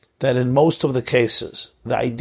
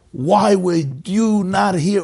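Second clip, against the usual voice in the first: retold sobbing plea; 6 semitones higher, slower than usual. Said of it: "he's sobbing".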